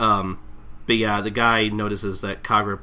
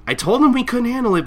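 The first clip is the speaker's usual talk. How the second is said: Shinpachi complaining; 14 semitones higher, 7 dB louder than usual. Retold or imitated. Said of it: "Shinpachi's like".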